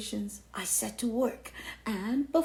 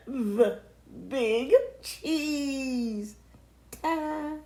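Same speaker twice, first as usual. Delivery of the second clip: grand announcement; 4 semitones higher, 3 dB louder, much slower than usual. Acted.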